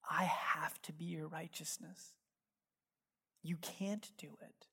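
hushed, low, near-whisper; gentle divine reassurance. Retold or imitated. Voices Jesus gently to him.

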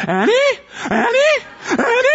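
very high, panicky questioning calls; frightened pleading. Retold or imitated. Frightened woman pleading with the charging dog. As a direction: plead with a strange dog, terrified. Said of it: "she's like".

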